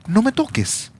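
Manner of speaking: high, lightly voiced Jesus; a hint of Jesus telling her to let go; Jesus to Mary, lightly voiced; gentle command. Jesus speaks to Mary, repeated.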